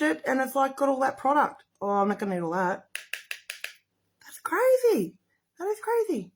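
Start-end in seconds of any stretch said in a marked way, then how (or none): 4.2-6.3 very high-pitched amazement; high, wowed exclamation; amazed. Real, not acted. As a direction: marvel at it, voice shooting way up. Amazed by the pigment.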